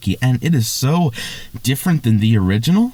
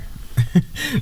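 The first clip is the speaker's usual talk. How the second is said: high breathy giggle; a fresh burst of giggling; 5 dB quieter than usual. Real, not acted. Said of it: "I can't stop laughing".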